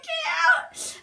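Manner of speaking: high, loud scream to leave; panicked yell. Retold or imitated. Dreamer to mum coming in, high panicked yell. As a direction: scream it at the intruder.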